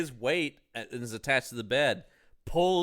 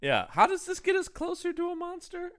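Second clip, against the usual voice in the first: much higher, exasperated questioning. Real, not acted. Co-host fed up with the plot, a high exasperated question. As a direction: plead for the monster to appear. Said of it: "exasperated question".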